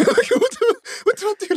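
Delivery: high, laughing panicked squeak; hammed-up panic, voice shooting up; laughing mock panic. Panicking to his mate before tying up, laughing mock panic. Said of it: "I'm panicking".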